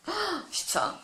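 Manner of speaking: high, soft sigh of a curse; exasperated swearing. Real, not acted. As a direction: curse softly at your own blunder. Swears after botching the name repeatedly.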